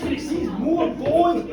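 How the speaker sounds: plain reading voice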